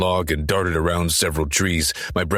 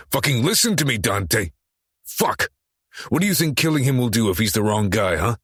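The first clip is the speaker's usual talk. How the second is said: shouting at him; 5 semitones higher than usual. Acted. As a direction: shake sense into him with your voice. Shaking Church, yelling at him.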